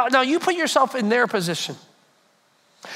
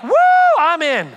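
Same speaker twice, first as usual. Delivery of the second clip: cheering; 5 semitones higher, 7 dB louder than usual. Retold or imitated. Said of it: "I would be like".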